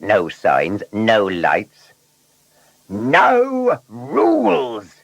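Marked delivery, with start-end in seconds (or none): 2.9-5.0 high, gleeful, very drawn-out words; excited. A narrator's reading. Blunderbuss alone on empty foggy lanes, excited. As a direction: savour it with rising excitement. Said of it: "thought Blunderbuss to himself excitedly".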